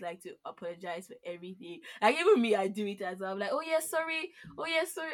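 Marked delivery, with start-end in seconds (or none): 3.5-5.1 retold apology. Voices own reflex apology.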